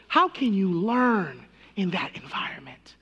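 breathy whispered question. Real, not acted. Pastor hushing the congregation with a whispered question. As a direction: drop to a hushed whisper to pull the congregation in.

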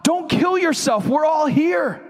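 high-pitched urgent cry; urgent shout. Acted. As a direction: call out to stop someone in danger. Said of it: "but Paul shouted to him".

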